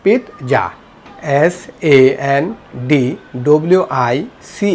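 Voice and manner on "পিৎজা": পিৎজা as "pit-ja"; correct pronunciation. Shown as a demonstration. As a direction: stop on the t, then "ja" in পিৎজা. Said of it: "সঠিক উচ্চারণ করব কি".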